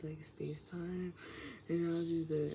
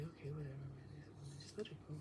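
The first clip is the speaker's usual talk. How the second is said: resigned mutter; 3 semitones lower, 9 dB quieter, faster than usual. Retold or imitated. Shrugging her off.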